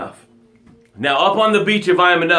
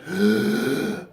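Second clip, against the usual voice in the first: sharp revived gasp; gasp for air; 4 semitones higher, 6 dB quieter than usual. Pretend. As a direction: suck in air like just saved from drowning. Resuscitated, gasping for air.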